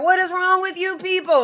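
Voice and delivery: high-pitched, exasperated outburst; indignant retold rebuke; furious Moses. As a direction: scold them, pitch leaping way up. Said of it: "He was so furious".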